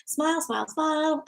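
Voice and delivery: dismissive parent. Retold or imitated. Parent to a crying child, high hurried coaxing. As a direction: hurry the child along, sweetly pushing them to smile. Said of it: "sometimes a parent will go".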